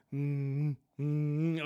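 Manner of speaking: mother's soothing hum, imitated; two drawn-out soothing hums; calming hum. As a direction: hum gently to calm a child down. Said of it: "she'll hum this thing where, like, she's calming us down".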